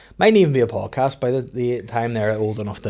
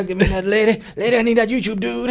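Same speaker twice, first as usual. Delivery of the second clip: much higher, begging; mock junkie kid; 10 semitones higher than usual. Child begging dad for YouTube, high junkie plea. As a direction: plead like an addict.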